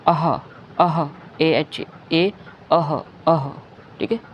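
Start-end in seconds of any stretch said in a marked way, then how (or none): 0.1-1.1 "अह" with an audible breathed h; "अह" said apart as two short beats; visarga sound. Shown as a demonstration. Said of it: "हम pronounce करते हैं". 2.7-3.5 "अह" ending in a clear ha; "अह" with the h released; visarga sound. Shown as a demonstration. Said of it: "हम pronounce करते हैं".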